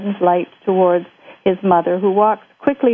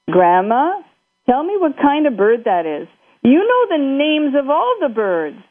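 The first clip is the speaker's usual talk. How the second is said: pressing; 6 semitones higher than usual. Acted. Jake pressing grandma.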